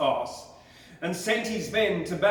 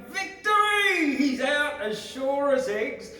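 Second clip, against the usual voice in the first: high, excited cry of victory; triumphant shout; 8 semitones higher than usual. A narrator's reading. Molongo players shout in triumph, high excited cry. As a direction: crow it out, sure they've won. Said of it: "Molongo shouted".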